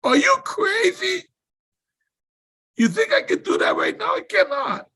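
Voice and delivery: very high, squealing retort; retold hysterical objection; incredulous protest. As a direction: act out a frantic refusal, pitch shooting up. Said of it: "I said".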